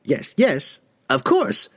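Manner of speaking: high, hurried frightened yes; erupting. A narrator's reading. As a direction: burst out in fear. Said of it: "the merchant erupted".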